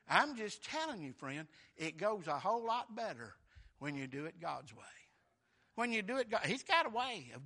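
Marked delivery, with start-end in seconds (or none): none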